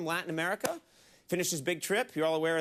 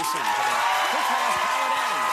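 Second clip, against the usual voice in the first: excited shout; 7 semitones higher, 8 dB louder, slower than usual. Real, not acted. Hyping the guest intro.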